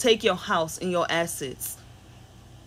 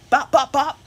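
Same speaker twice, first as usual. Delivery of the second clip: punchy bop sound effects, louder; playful imitated punches, higher and louder; mock hitting noises; 4 semitones higher, 6 dB louder than usual. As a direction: knock out each bop like a blow. Imitating the law hitting people.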